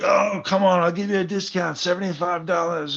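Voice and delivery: high-pitched wheedling salesman; imitated haggler pleading for a sale; pushy seller imitation. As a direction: coax the buyer in a high mock voice. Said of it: "He'd be like".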